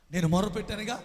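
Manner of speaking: ordinary talk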